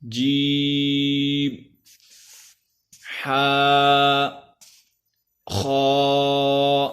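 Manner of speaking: each letter drawn out slowly in recitation; alphabet drill. Shown as a demonstration. Teacher reciting the Arabic alphabet for practice. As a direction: chant each letter long and clear.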